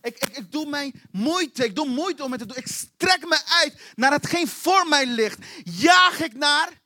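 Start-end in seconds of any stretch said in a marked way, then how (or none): 2.6-6.8 slow, stretched-out words with rising force; loud, effortful preaching; straining forward. Shown as a demonstration. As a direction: drag out the verbs as if reaching hard.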